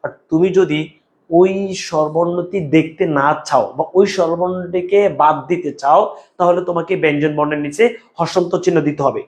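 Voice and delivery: ordinary talk, nothing marked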